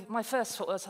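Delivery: ordinary delivery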